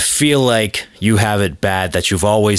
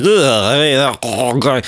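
drawn-out mock groan of disgust; exaggerated "ugh"; 3 semitones higher than usual. Disgusted reaction to people mocked.

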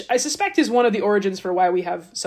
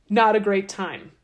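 normal talking voice

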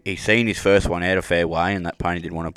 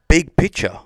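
emphatic praise; 9 semitones higher, 6 dB louder, slower than usual. Real, not acted. Admiring the kid's restraint.

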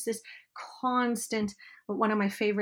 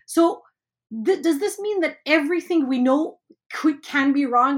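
higher, louder shocked question; surprised student; 5 semitones higher, 7 dB louder than usual. Retold or imitated. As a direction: ask it like a stunned student. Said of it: "one of my students said".

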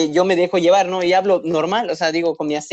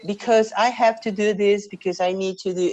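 higher imitation of an English teacher; explaining teacher; 4 semitones higher than usual. Teacher answering a misheard question to her student, explaining herself.

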